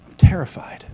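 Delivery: unvoiced, fearful whisper; frightened whisper. Elijah in the cave, terrified whisper. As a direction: breathe it out scared. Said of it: "he's like".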